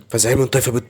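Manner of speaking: usual speaking voice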